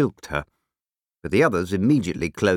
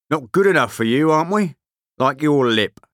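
loud, higher, aggressive challenge; belligerent big man; 6 semitones higher, 5 dB louder than usual. A narrator's reading. Big man rounding suddenly on the short man, belligerent. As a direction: round on him, spoiling for a fight. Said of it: "he rounded suddenly on the short man and said".

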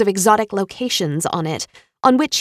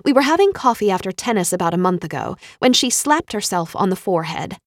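usual speaking voice

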